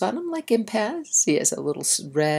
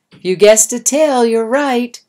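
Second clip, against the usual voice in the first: much louder and higher, cheering answer; excited reveal; 5 semitones higher, 11 dB louder than usual. Real, not acted. Teacher telling children the answer, loud and cheering.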